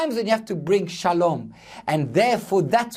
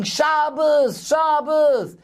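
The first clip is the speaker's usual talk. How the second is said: drawn-out, higher-pitched chanted cry; retold protest shout; 8 semitones higher, much slower than usual. Demonstrators crying out against a passing car, retold shout. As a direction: call it out like a street protester. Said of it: "they were shouting".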